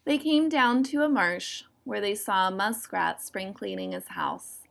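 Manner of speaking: ordinary delivery, nothing marked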